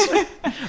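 a sudden high laugh; high squeal of laughter. Real, not acted. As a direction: crack up. Laughs at own quote.

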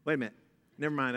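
usual speaking voice

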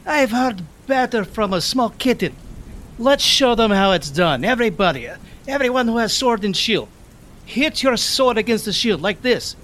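high, carrying battle cry to the troops; rallying yell. Acted. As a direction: rouse the soldiers, shouting up high. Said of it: "I'll yell out".

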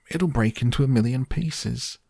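high, anxious protest at moderate volume; alarmed shout. A narrator's reading. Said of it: "shouted Grandpa Joe".

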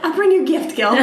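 high playful Russian cat voice. Pretend. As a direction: voice a cheeky cat, laughing. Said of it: "Mishka's voice".

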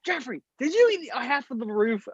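put-on high, accusing girlfriend voice; high-pitched suspicious partner. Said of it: "She's like".

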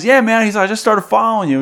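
high, loud casual fan voice; the fan keen and chummy; friendly fan. Retold or imitated. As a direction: stranger at the urinal, high and eager. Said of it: "he goes".

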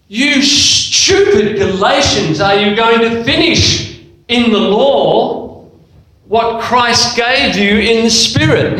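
a mock-furious Paul chewing out his church; Paul's rebuke. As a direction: scold them like an exasperated apostle.

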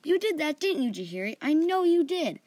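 high, loud accusation; angry realization. A narrator's reading. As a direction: accuse sharply as it dawns on you. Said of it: "anger, and realization".

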